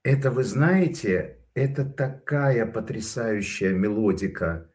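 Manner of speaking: usual speaking voice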